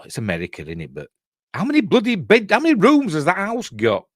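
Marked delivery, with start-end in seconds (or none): 1.5-4.1 high, louder disbelieving outburst; can't believe how big it is; incredulous. Real, not acted. Streamer marvels at the film's huge house, incredulous.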